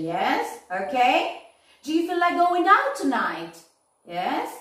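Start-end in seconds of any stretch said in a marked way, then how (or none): 1.8-3.5 "Do you feel like going out tonight?" higher, falling on out; intonation demo. Shown as a demonstration. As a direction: point the class at the tune. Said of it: "notice the intonation".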